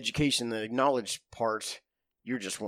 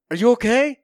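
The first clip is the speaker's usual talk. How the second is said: much higher, louder worried call; alarmed call; 12 semitones higher, 11 dB louder than usual. Retold or imitated. Shaken guy in the porta-john calling up, worried. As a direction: shout up to check on him, mock worry. Buddy calls after sandbag bang.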